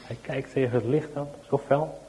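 plain reading voice

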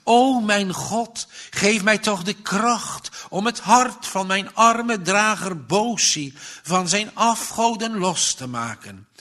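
put-on high, loud, earnest child's prayer; childlike prayer aloud. A narrator's reading. Henry praying aloud for his bearer Bosi, fervent childlike prayer. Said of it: "En Henry bidt opnieuw hardop zijn kinderlijk gebed".

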